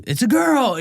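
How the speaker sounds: high-pitched celebratory call; excited announcement. Retold or imitated. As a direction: re-enact crowing the news to the guys. Announcing daughter's birth to the guys.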